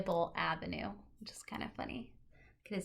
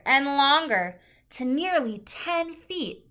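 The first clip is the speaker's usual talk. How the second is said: lively picture-book read-aloud; reading to children; 11 dB louder, slower than usual. Acted. Teacher reading a book to the class, lively and clear.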